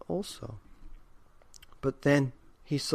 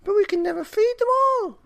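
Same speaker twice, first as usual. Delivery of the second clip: put-on very high, loud old woman's voice; worried old woman; 9 dB louder than usual. A narrator's reading. Old wife worries to husband, very high fretting. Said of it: "said the very old woman".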